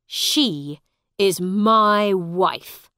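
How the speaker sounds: loud, slow, possessive declaration; angry. A narrator's reading. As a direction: lay down each word forcefully. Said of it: "said Hugh angrily".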